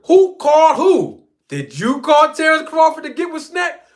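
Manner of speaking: high, loud shouted question; mock super chat yell. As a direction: yell the challenge at Victor. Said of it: "asked Victor Conti".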